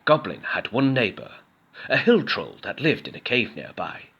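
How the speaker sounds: usual speaking voice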